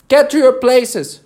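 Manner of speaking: high, booming royal shout; voice of thunder. A narrator's reading. The Queen starts the game, thunderous shout. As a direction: thunder out the order. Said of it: "in a voice of thunder".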